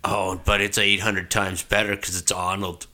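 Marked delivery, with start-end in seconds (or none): none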